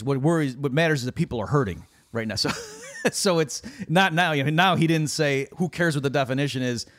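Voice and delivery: ordinary delivery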